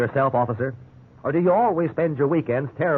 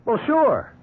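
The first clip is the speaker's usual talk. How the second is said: eager; 9 semitones higher than usual. Acted. Eager reply to lawyer offer.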